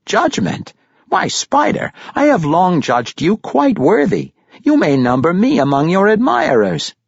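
very high, loud grandfatherly praise; delighted Pericolo. Acted. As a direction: exclaim admiration in a high character voice. Narrator voices delighted Pericolo.

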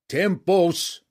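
loud, high battle cry; shouted. A narrator's reading. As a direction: roar the god's name to the rafters. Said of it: "they shouted in unison".

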